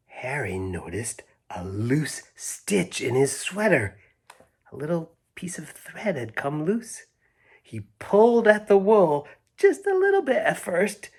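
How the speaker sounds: hushed storybook narration sinking to a whisper; reading to children. Acted. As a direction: draw the kids in as the suspense builds. Reads the story aloud to children.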